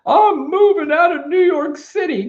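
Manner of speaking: very high, loud mocking wail; mock cowardly whining. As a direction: imitate a panicky whiner in an exaggerated high voice. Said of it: "That pussy mentality that so many".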